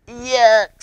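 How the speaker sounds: mock-disgusted child; Ivy's disgust. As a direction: turn up your nose at it. Ivy disgusted at pickles.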